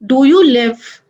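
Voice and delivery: ordinary delivery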